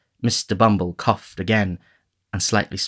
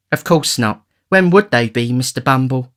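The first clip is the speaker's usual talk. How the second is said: high, prim matron voice; a coy, prim lady; genteel matron; 4 semitones higher, 3 dB louder than usual. Acted. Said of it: "said the matron".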